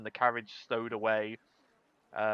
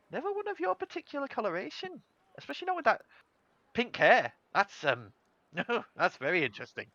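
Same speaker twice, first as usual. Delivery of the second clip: Polly; 9 semitones higher, faster than usual. Acted. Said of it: "lovely old halfling grandmother called Polly".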